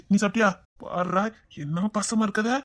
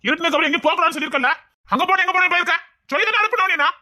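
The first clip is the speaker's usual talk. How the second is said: high, heated telling-off; angry scolding; 7 semitones higher than usual. Acted. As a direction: scold her with pitch raised right up. என்ன திட்டிக்கிட்டு இருக்கா. (கோபமாக உயர்ந்த குரலில் திட்டுதல்)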